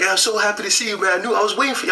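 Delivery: high, happy welcome, quickening at the end; the elder welcoming him at last, bright and high; joyful greeting. Retold or imitated. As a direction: greet a long-awaited brother with delight.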